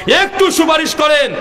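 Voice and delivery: high, ringing entreaty; acted desperate plea. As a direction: beg in a high preacher's cry. People begging a prophet to intercede.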